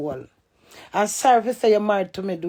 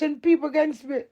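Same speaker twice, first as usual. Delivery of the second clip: tearful; 4 semitones higher, 3 dB quieter than usual. Real, not acted. Telling her husband he turned people against her, tearful. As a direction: voice catching into a cry. Hurt complaint, cry heard.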